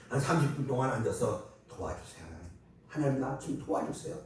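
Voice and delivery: ordinary delivery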